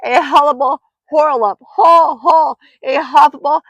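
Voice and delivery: high, loud, words tumbling into muddle; panicked crying out. A narrator's reading. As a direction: shriek so frantically that the words get jumbled. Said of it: "still crying out".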